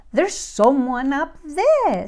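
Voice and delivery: a touch of fright, drawn out; mildly frightened Baby Bear. A narrator's reading. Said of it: "rather frightened".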